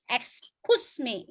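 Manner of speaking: "excuse me" very slow, U singled out; long U sound. Shown as a demonstration. Teacher pointing out the U, slow drawn "excuse me". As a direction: separate and lengthen the U in "excuse me". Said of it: "itu U".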